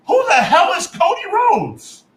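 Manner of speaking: high, loud indignant yell; outraged shout. Real, not acted. Fan ranting to co-host, loud outraged shout. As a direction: shout it in disbelief. Ranting about wrestler booking.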